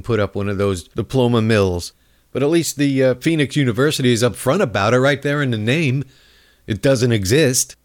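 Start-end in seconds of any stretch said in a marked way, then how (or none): none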